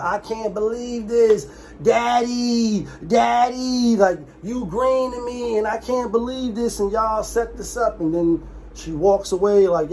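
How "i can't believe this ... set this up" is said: higher, slowed, lightly whiny wail; a hint of a hysterical girl filming; mock distraught daughter. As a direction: imitate her crying out to daddy on live. Daughter on live, father dying.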